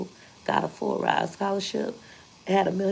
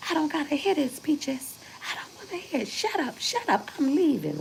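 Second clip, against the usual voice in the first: high, shrill-pitched angry grandma storming off; imitating grandma yelling, pitched way up; 10 semitones higher than usual. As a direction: squawk it out like a furious grandma walking away. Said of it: "she's gonna yell, cuss, and leave".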